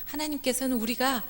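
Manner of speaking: ordinary talk, nothing marked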